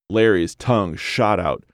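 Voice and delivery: ordinary delivery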